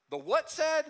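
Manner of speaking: high prompting question, waiting for the crowd; high teasing prompt for the congregation; prompting call-and-response. Shown as a demonstration. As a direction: pitch The what said? up high, coaxing the congregation to answer. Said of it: "let's do it again".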